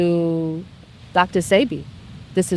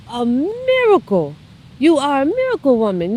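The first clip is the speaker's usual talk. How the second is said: awed doctor; 6 semitones higher than usual. Retold or imitated. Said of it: "he goes".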